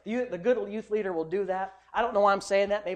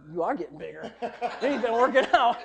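usual speaking voice

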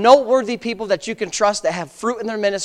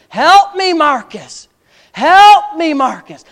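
high, loud cry for help, dropping on the name; preacher re-enacting desperate screams; imitated screaming; 6 semitones higher, 7 dB louder, much slower than usual. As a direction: cry out like a wounded soldier calling a buddy. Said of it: "his buddies just screamed".

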